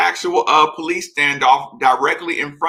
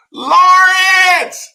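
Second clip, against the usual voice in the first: mock shout; 8 dB louder than usual. Host shouting at chat user.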